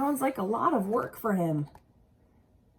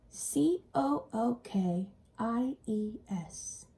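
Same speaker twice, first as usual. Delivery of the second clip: spelled out; much slower than usual. Shown as a demonstration. Spelling word out for kids.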